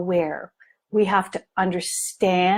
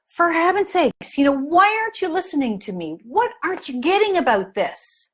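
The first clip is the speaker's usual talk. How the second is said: mild mock anger; 8 semitones higher, faster than usual. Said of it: "I would get very angry".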